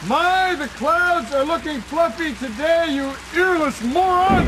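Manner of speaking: exasperated yell. Pretend. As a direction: bellow the code phrase in frustration. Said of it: "I, I said".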